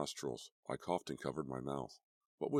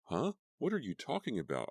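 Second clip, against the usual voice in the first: panicked high outburst; a mild scared shriek; frightened shriek; 7 semitones higher than usual. A narrator's reading. Diane denying the ghost's charge, frightened. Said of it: "I shrieked".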